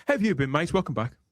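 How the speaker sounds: ordinary talk, nothing marked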